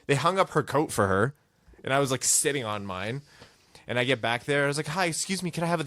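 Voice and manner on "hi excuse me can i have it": higher-pitched meek asking; imitated hopeful politeness; polite request. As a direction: ask timidly, as if interrupting. Timid request to flight crew.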